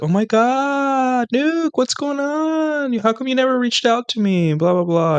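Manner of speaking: mocking the ex who texted first on Hinge, high and slow; imitating her. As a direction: ham up a gushing woman, stretched out. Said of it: "She was like".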